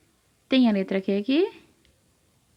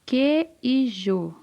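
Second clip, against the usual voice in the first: "queijo" stretched slowly as que-i-jo; syllable by syllable; 4 semitones higher than usual. Shown as a demonstration. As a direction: break "queijo" into slow, separate sounds, que, i, jo. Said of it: "Começa com qual sílaba?". ("queijo" devagar, separando que-i-jo)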